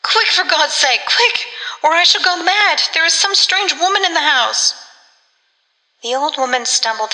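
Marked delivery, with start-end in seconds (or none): 0.0-4.7 high-pitched panicked plea, short of shouting; frantic cry for help, pitched right up; rising to scream. A narrator's reading. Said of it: "Her voice rose suddenly to a scream".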